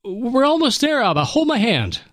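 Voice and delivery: put-on old father near tears, trying to stay calm for his son; weeping. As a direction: push the words through tears. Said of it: "The old man was weeping now".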